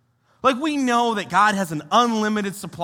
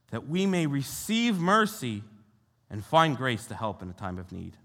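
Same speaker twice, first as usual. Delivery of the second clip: ordinary talk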